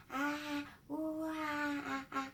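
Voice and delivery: put-on soft, high cooing vowels like a baby; soft babbling baby vowels; baby vowel sounds. Shown as a demonstration. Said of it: "babies make a lot of vowel sounds".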